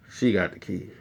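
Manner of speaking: low, very soft imitation of the cousin; small, low mock confession; sheepish admission. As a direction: admit it quietly, dropping the pitch. Mimics cousin's sheepish reply.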